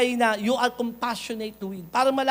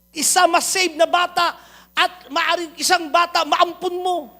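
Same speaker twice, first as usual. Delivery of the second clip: higher, louder pulpit exhortation; rally the congregation, pushing higher; fervent preaching; 4 semitones higher, 8 dB louder than usual. Acted. Pastor urging listeners to adopt a child, fervent and high.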